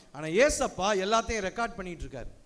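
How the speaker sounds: ordinary talk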